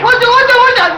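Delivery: high frantic yelps of ஊசி; patient yelping in fright at the injection; panicked cry. Acted. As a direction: yelp at the needle in fear. Panics at the needle.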